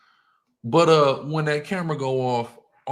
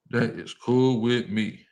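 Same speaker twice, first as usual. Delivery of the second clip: each word spaced out; slow right down and separate every word; slow, deliberate; 3 semitones lower, slower than usual. Real, not acted. Answering what people say about him, slow and deliberate.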